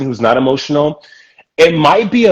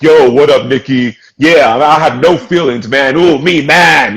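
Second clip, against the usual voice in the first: louder, tough-guy bravado with no feelings; macho bro voice; 4 dB louder, slower than usual. Retold or imitated. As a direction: puff up like a guy hiding every feeling. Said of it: "You gotta be like".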